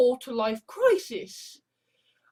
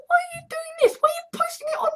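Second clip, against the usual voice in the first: mocking people; 3 dB louder, faster than usual. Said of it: "suddenly people are like".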